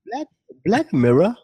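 high-pitched mock British accent. Co-host mocking the show's name in British accent. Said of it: "say in a British accent".

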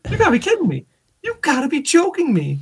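you gotta be kidding, mock-incredulous; disbelief. Husband imitating his disbelief at wife over door handle.

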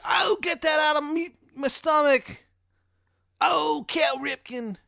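high, loud, hammed-up cries of torture; tortured Shithead Steve. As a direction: howl in mock agony with a bad Maryland accent. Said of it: "like, tortured".

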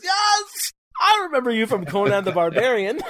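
high, excited mock shout; gleeful villain shout. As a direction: crow it like a thrilled movie villain. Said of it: "He goes".

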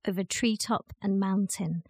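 ordinary delivery, nothing marked

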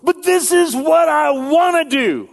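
high, loud whining wanting voice; voice of desire. Retold or imitated. Preacher voicing the want against the should, high whiny plea. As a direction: wail it like a greedy kid. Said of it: "the voice of my desires says".

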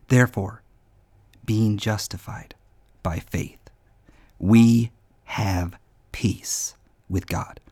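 low, slow reverent reading; solemn scripture reading. Acted. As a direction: read the verse slowly, hushed. Reads Romans five one.

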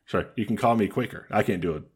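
ordinary talk, nothing marked

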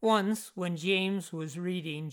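ordinary delivery, nothing marked

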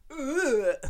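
high groan of self-disgust. Real, not acted. Podcaster cringing at his own accent, disgusted groan. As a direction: groan in real cringing dismay.

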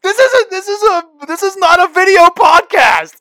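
high, loud mock outrage; imitated angry yelling. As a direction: mimic your co-host losing it. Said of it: "You just start yelling".

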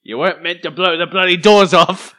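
put-on loud, high Cockney accent; Michael Caine impression. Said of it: "my Michael Caine impression".

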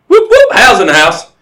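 loud, high whoops with a faked Irish lilt; loud mock-Irish hype call; Irish announcer catchphrase. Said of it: "but in an Irish accent".

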